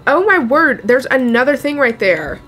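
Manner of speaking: loud, high startled outburst; alarmed. Real, not acted. Player spotting another threat right there, alarmed. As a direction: burst out loudly in alarm.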